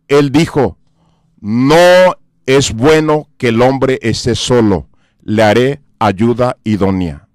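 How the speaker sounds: solemn preaching. Acted. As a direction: let each word land slowly like scripture. Solemn scripture point, slowed. (predicación solemne y lenta)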